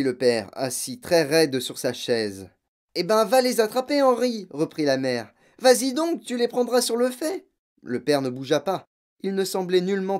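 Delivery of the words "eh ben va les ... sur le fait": high, loud nagging; urgent prodding. A narrator's reading. Mother pushing husband to catch burglars, urgent. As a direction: goad him on in a raised voice.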